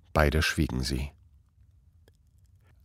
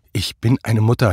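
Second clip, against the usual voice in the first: firm, louder retort; insistent retort; 4 semitones higher, 7 dB louder than usual. A narrator's reading. Anna insists to her husband she must come, louder firm retort. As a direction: push back at him, louder. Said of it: "hielt sie ihm entgegen".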